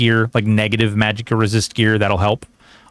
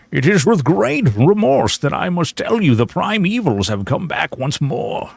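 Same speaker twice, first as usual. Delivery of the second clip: Deckard Cain impression; 6 semitones higher than usual. Streamer doing a Deckard Cain impression for chat, higher grave old sage. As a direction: intone like a solemn old sage with bad news. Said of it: "would you like some Deckard Cain?".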